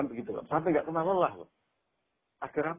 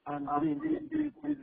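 higher, playful call of a name; mock wrong echo; 12 semitones higher, slower than usual. Shown as a demonstration. Preacher shows what an echo never does, higher call. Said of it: "Nggak mungkin kan gitu".

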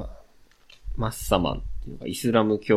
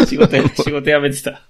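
laughing; 6 semitones higher, 6 dB louder, slower than usual. Real, not acted. Recalling a friend's quitting.

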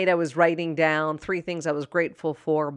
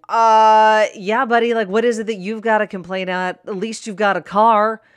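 louder scoffing sarcasm; sarcastic inner voice; 4 semitones higher, 9 dB louder than usual. Retold or imitated. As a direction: scoff at the coworker's complaint. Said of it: "you're over there thinking".

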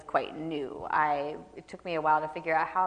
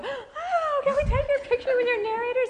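squealing mock fan voice, much higher and louder; gushing librarians; 6 dB louder than usual. Imitating librarians fawning over the handsome narrator, high and giddy. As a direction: coo it like a starstruck librarian.

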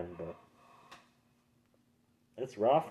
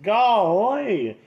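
high, loud cartoonish exclamation; cartoon golly; 12 semitones higher, 10 dB louder than usual. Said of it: "he's like".

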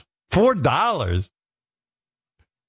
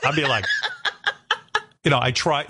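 ordinary talk, nothing marked

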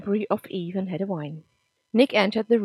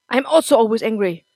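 loud confession; 3 semitones higher, 9 dB louder than usual. Acted. Nick confesses anger loudly.

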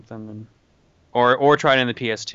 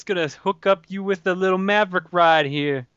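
gangster voice; 6 semitones higher than usual. Pretend. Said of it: "If only I could do a good gangster voice".

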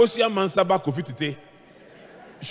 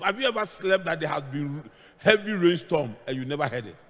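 ordinary talk

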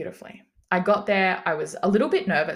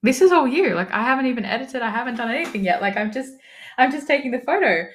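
excited reassurance; 6 semitones higher, 6 dB louder than usual. Retold or imitated. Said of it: "And she was like".

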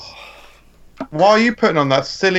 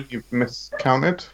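ordinary talk

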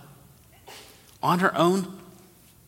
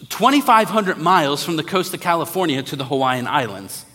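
ordinary talk, nothing marked

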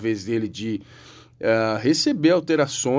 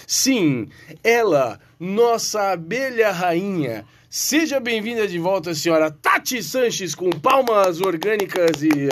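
announcer; 5 semitones higher than usual. Acted. Host opens the show.

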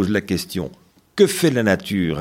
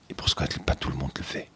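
question chuchotée d'enfant; 6 semitones lower, 10 dB quieter, much faster than usual. Retold or imitated. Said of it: "Et les enfants disent".

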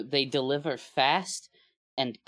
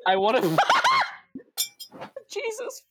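laughing; 4 dB louder than usual. Real, not acted. Laughing again at joke.

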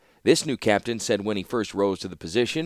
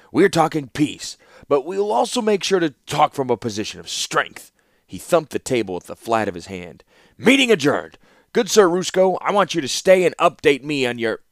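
higher and louder, commanding; a hint of a forceful warlord, authoritative; firm pronouncement; 6 semitones higher, 6 dB louder than usual. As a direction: declare it like a ruler ending a council. Warlord asserts, dismissing the meeting.

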